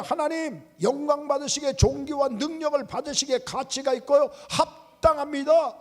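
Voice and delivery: high-pitched declaration of praise; elders' praise. Acted. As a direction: proclaim worship to God, pitch held high. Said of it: "이십사 장로들이 하나님 앞에 찬양을". (높은 음조로 찬양하듯 선포)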